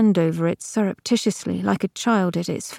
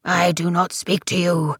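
croaking. A narrator's reading. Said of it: "croaked Crux with solemn dignities".

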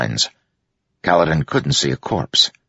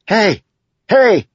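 high, loud shout for attention; yell; 6 dB louder than usual. A narrator's reading. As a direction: yell "hey" sharply to draw it away. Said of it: "She yelled again".

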